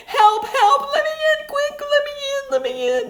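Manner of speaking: very high, loud pleading squeal; frightened pig. Acted. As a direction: beg to be let in, out of breath. Brother pig begs entry.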